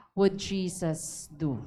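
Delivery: slowed, quiet, reflective question; slow modeled question. Shown as a demonstration. Pastor models the question to ask oneself, slow. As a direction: ask it slowly to yourself. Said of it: "tatanungin yung sarili mo".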